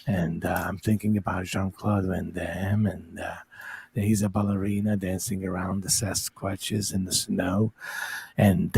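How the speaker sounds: hushed, halting macho-guru murmur; Steven Seagal. Acted. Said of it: "this is Steven Seagal hideout".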